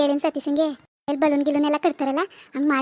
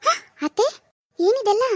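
high, delighted surprise at the decorations; startled and thrilled; surprised gasp; 6 semitones higher than usual. Acted. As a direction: gasp it out, caught off guard. Sees the birthday surprise.

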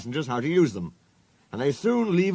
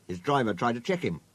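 usual speaking voice

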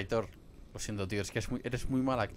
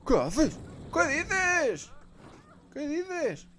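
shocked yell; 8 dB louder, much slower than usual. Real, not acted. Startled outburst during play.

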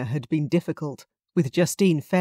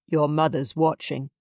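ordinary delivery, nothing marked

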